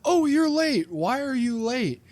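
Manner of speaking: high, mocking teacher scold. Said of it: "And she's like".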